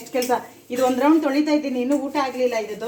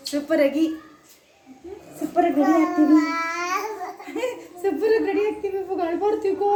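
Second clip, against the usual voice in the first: cheerful cooing; 6 semitones higher than usual. Real, not acted. Cooing to baby after bath.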